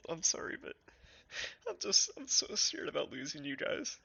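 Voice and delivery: talking through leftover tears; after crying. Real, not acted. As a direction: still teary, voice unsteady. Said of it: "I need to wipe away my tears".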